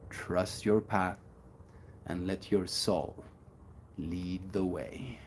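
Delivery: slow, soft, sincere delivery. Shown as a demonstration. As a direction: ease into it gently, fading to a whisper. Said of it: "slow soft inspirational delivery".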